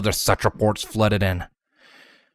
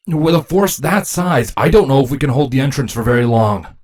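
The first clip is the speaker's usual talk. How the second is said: higher, urgent battle report; a touch of alarm; worried soldier; 5 semitones higher, 5 dB louder than usual. Acted. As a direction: warn the royals the line may not hold. Drone reports being overwhelmed in battle.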